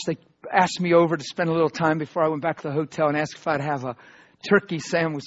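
ordinary delivery

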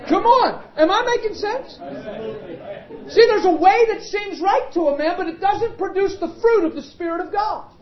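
high, loud preacher's climax; full-throated sermon peak; preaching at full force. Acted. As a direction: hammer the point home to the congregation.

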